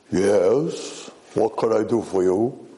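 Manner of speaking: lightly put-on ugly hag voice; a hint of character voice; Hilda the Horrible. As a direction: answer as the horrible servant woman.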